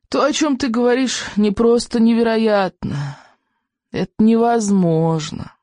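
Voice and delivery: put-on slow, high creaky old man. A narrator's reading. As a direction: speak like a frail old healer. Old man figure speaking.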